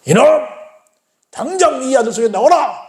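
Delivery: high, commanding rebuke; stern rebuke. Acted. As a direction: order the demon out sharply. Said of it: "꾸짖습니다". (귀신을 꾸짖어 명령하는 말투)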